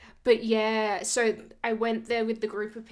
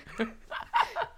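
laugh. Real, not acted. Laughs at awkward memory.

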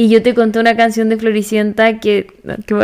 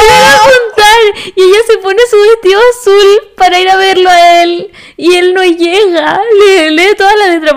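voz aguda, arrastrada, al borde del llanto; llorosa; 7 semitones higher than usual. Real, not acted. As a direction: contar la tragedia lloriqueando. Llora al contar la canción. (lamento agudo y emocionado)

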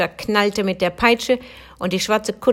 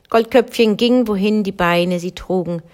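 plain reading voice

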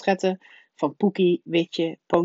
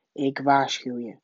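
lightly voiced uncle, slightly lower; warning uncle; 3 semitones lower than usual. A narrator's reading. Uncle Herman up close to Harry's face, warning. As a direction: repeat the warning firmly.

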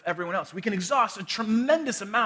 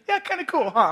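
mom's cheerful reply; 8 semitones higher, 5 dB louder than usual. Retold or imitated. Mom's delighted reply, voiced.

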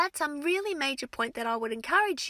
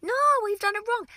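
put-on protesting kids; 8 semitones higher than usual. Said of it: "they're always sort of".

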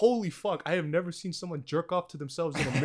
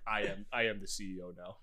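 mock villain CEO; 9 semitones lower, 15 dB quieter than usual. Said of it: "walk in and be like".

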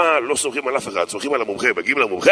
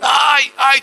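loud, high "ai, ai" yelps; mock cries of pain; 12 semitones higher, 5 dB louder, slower than usual. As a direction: yelp out as if in agony. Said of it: "באמת, איזה צעקות".

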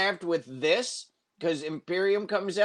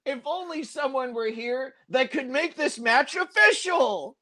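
hinting wrestlers; 8 semitones higher than usual. Retold or imitated. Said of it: "then they go".

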